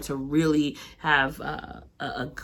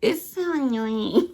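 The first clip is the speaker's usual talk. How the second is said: put-on baby voice; 8 semitones higher, slower than usual. Whining in baby voice.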